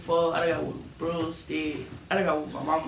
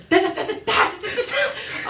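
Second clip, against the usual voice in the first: mock yell. Imitating the sister's yelling at grandma, high mock shriek. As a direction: shriek the syllables like a tantrum. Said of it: "This motherfucker yell".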